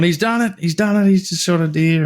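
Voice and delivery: high-pitched mock cheering, easing down by the end; excited announcement. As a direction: crow the news in a high voice for your mate. Blokes announce his first deer.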